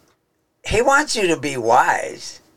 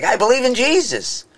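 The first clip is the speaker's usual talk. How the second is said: a much higher, lightly voiced sing-song believer; mock believer; 10 semitones higher than usual. Showing words said without belief, mock high sing-song. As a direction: toss off the words as an empty declaration. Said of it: "You know, you say, like".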